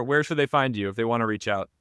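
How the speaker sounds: ordinary delivery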